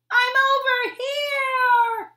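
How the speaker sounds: high, loud slow yell. Acted. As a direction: bullfrog yelling to rescuers through the rain, high loud. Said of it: "he yelled".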